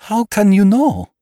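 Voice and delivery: high, loud, anguished outburst; horrified cry. A narrator's reading. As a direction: cry it out in horror. Horrified repeat of the question.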